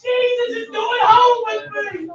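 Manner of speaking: high, loud cry of joy; joyful whoop. Retold or imitated. Preacher voicing his joy at Jesus coming home. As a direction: whoop it out like you just got picked. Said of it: "I said".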